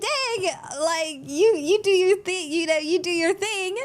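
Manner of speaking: playful shrug. Retold or imitated. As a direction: wave it off with a grin. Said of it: "And I was like".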